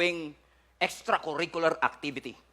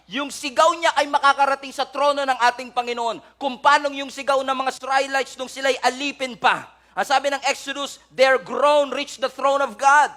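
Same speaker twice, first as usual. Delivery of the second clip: loud, high fervent preaching; preaching at full force; 9 semitones higher, 7 dB louder than usual. Acted. Preacher crying out to the congregation, loud and fervent. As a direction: preach it out with rising fire.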